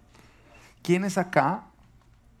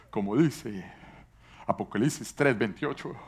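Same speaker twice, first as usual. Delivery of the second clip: imitated Bible reciting; 4 semitones lower than usual. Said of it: "recitan la Biblia mientras que oran".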